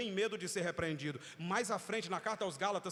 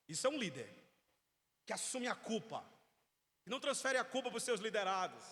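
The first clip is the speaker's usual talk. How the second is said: ordinary delivery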